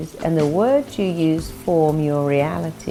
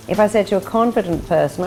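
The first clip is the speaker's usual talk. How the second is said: usual speaking voice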